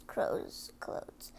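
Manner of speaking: ordinary delivery, nothing marked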